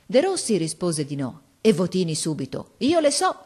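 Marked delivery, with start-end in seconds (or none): 2.8-3.3 much higher, louder, showing off; boy boasting he knows the poem; smug boast. A narrator's reading. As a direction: say it to score a point over De Rossi. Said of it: "con un sorriso come per fare una picca".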